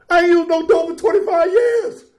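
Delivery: high, loud triumphant call; proud shout. Real, not acted. Speaker proclaiming his sobriety to the meeting, triumphant. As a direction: declare it to the whole room.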